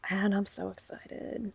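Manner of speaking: slow, breath-only whisper; whispered excitement. Real, not acted. Podcaster on another match with the Revival, excited whisper. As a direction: whisper it like a thrilled secret. Whispered aside about an upcoming rematch.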